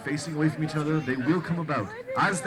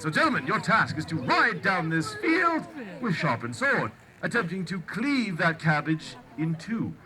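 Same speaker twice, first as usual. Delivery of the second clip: renaissance fair olde speech. Acted. Joust announcer, olde speech.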